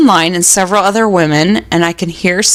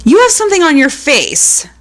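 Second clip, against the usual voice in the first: lightly exclaimed, pitched up; a touch of a toddler's exclamation; mild mock exclamation; exclaiming toddler; 6 semitones higher than usual.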